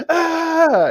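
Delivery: goose hiss shriek. Retold or imitated. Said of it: "And it's like".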